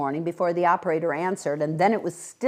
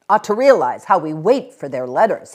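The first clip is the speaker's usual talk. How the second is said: cross grumble; 10 dB louder than usual. A narrator's reading. Husband grumbling about children.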